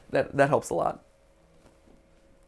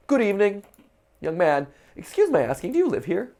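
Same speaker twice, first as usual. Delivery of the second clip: lightly voiced old resident, pitched up; lilting, higher-pitched polite questioning; 7 semitones higher, 3 dB louder than usual. Resident questioning a young stranger at the door, lightly put-on polite voice. Voicing resident greeting the player.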